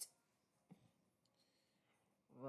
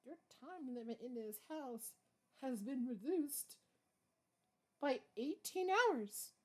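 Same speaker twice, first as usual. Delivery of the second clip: villain monologue; 6 semitones higher, 6 dB louder than usual. Pretend. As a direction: pronounce doom on the gnat. Mock villain threat to gnat.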